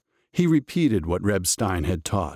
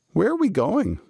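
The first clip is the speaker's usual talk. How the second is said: Muddle voice; 12 semitones higher than usual. A narrator's reading. Muddle asks, high voice.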